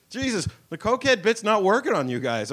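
normal talking voice

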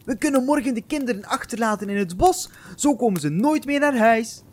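high, fast and sly; eager scheming stepmother. Acted. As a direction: rush the wicked idea out. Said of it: "haar ogen sprongen wijd open en al snel zei ze".

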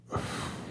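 soft, airy sigh; helpless sigh. Acted. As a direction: let a quiet breath out in defeat. Helpless after searching for friend.

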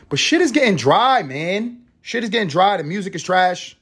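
fed-up ranting; exasperated rant. Real, not acted. Ranting complaint, pitch up.

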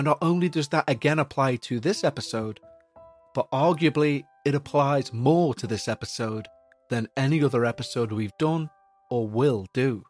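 plain reading voice

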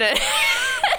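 a sudden squealing laugh; high burst of laughter. Real, not acted. Guest laughing at her own huffing and puffing, high squealing laugh.

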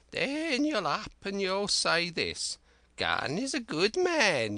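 high, slow, cracked voice of a wild marooned sailor; Ben Gunn. Acted. Marooned Ben Gunn eagerly pleading with young Jim, high and slow.

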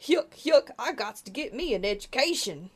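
mock a phony Southern accent; fake Southern accent. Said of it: "they're doing the Southern accent that people do".

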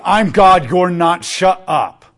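loud, dismissive mock rebuke; angry God. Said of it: "He's not saying".

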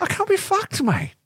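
Aussie line squeaked way up high; squeaky Australian accent. Pretend. Co-host hams up squeaky Australian accent for laughs. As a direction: pitch the Australian catchphrase into a silly falsetto.

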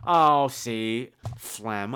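usual speaking voice

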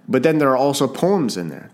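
normal talking voice

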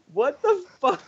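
high, stunned what the fuck; a sudden yelp of disbelief; shocked outburst. Retold or imitated. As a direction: recoil in disbelief at the screen. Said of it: "And I was just like".

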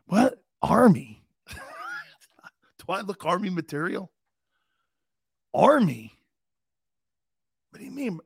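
disbelieving What, Army; shocked questions, rising and falling; incredulous. Retold or imitated. Teen answering grandfather's army threat, incredulous.

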